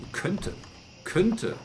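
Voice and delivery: "Könnte" lifted high and punched; stress on könnte. Shown as a demonstration. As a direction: lean hard on "Könnte" with a falling ending. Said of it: "Die Betonung liegt auf, genau, auf könnte".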